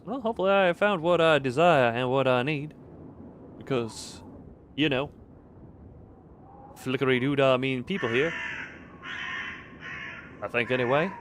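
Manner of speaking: lightly put-on Russian accent; a touch of improvised, dubious Russian accent. Streamer improvising a character voice in the game, mock Russian accent. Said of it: "this is the accent I decided to go for".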